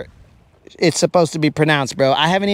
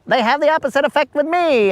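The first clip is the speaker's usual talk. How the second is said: much higher and fast, smug teasing; mock cocky flirt; 10 semitones higher, faster than usual. Colin flirting with the woman, hammed-up smug voice. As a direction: lay on the cheesy charm, fast and high. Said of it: "they'd be like".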